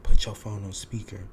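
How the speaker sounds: loud, commanding order; friend's loud demand. Retold or imitated. Friend on the phone demanding the speakerphone, loud and commanding. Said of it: "friend got on the phone and was like".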